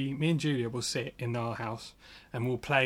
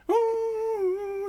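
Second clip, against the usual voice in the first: squeal; 5 dB louder than usual. Acted. Playful squeal after joke.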